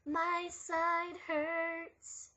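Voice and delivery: very slow, drawn-out whine. Acted. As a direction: stretch every word out slowly. Drawn-out whining complaint.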